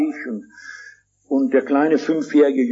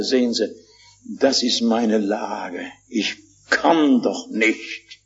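low, slow, drawn-out despair; retold heartbreaking plea; 5 semitones lower, much slower than usual. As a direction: act out a broken man, low and heavy. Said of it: "Es war herzzerreißend". (nachgespielte herzzerreißende Klage, tief und langsam)

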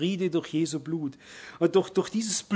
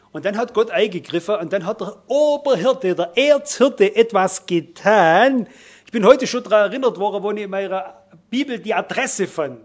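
emphatic preaching; 3 semitones higher, 7 dB louder than usual. Acted. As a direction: proclaim the chief shepherd boldly. Preacher exclaiming God's act.

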